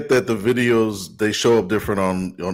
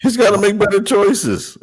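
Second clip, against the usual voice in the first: high-pitched shouted cut-in; emphatic interjection; 12 semitones higher, 4 dB louder than usual. Real, not acted. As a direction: cut in high and loud, on the edge of laughing. Co-host cuts in emphatically, then laughs.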